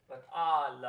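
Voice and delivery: al with an open A, said loud; correct al. Shown as a demonstration. Choir teacher giving the altos the right vowel. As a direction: say al with a bright open A. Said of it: "we're going to sing".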